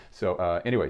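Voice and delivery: ordinary talk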